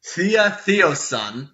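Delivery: slow, measured proclamation; booming female voice. Acted. Orc chief introduces herself to a trespasser, booming female voice. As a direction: announce it with a chief's authority. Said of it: "booming female voice from before say".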